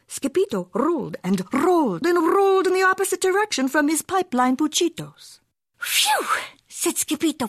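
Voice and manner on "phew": a much higher, breathy puff of relief; relieved phew. Acted. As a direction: blow out a winded phew.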